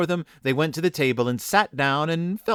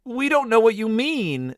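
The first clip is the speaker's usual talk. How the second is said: put-on high, plaintive children's voice; crying out; 10 semitones higher than usual. A narrator's reading. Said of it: "they cried".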